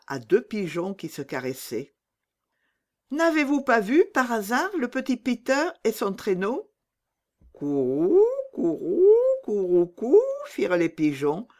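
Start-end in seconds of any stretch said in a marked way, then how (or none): 3.1-6.7 put-on higher, hopeful little girl; young girl Gerda. Gerda asks the pigeons. 7.5-10.2 a cooing bird call, rising; pigeon coo. Acted.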